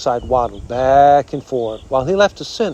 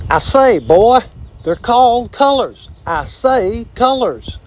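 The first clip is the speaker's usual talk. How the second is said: high, blustery southern drawl; animated southern drawl, stretched words; 9 semitones higher than usual. A narrator's reading. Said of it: "said the armadillo in the southern drawl".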